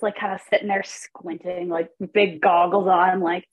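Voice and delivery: ordinary talk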